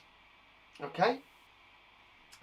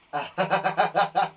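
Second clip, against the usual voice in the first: loud, rattling laugh; real burst of laughter; 5 dB louder than usual. Real, not acted. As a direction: laugh at your own silliness. Laughs after apologising for accent.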